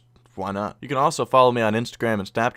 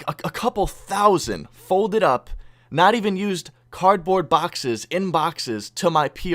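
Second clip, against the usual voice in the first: slow, higher, incredulous retold complaint; exasperated clerk; 5 semitones higher, 3 dB louder, slower than usual. Post office clerk complains to customer about boxes, exasperated. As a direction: spell it out slowly, pushing the key words.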